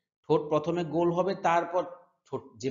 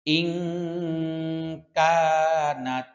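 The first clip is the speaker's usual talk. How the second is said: slow melodic chanted recitation; Quran recitation; 2 semitones higher, 5 dB louder, much slower than usual. Shown as a demonstration. Teacher chanting verse slowly for students. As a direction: chant each word drawn out for students to repeat.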